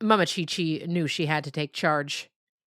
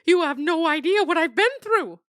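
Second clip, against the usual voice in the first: frightened dog voice, much higher and louder; pleading captive dog; 12 semitones higher, 6 dB louder than usual. Acted. Dog complaining.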